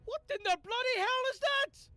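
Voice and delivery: high, full-force shout of alarm; Quinn. Acted. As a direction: yell it in loud, high-pitched disbelief. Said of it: "And Quinn looks and goes".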